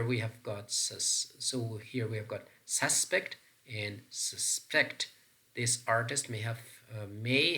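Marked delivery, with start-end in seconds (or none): 2.6-3.4 "suspect" as SUS-pect; first syllable of "suspect" stressed. Shown as a demonstration. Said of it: "they are pronounced in different ways". 4.1-5.1 "suspect" as sus-PECT; second syllable of "suspect" stressed. Shown as a demonstration. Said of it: "stress pattern on the second syllable".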